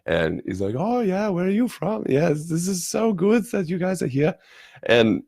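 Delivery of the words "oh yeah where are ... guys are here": high-pitched imitation of a German speaking English; broken-English German accent. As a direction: put on an eager German accent, much higher. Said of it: "a little bit broken English".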